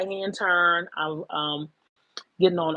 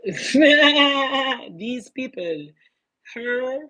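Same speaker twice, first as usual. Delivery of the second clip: "these people" stretched very slowly, higher and louder through a laugh; laughing drawn-out; 6 semitones higher, 5 dB louder, much slower than usual. Real, not acted. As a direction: laugh it out long and high. Repeats it, laughing.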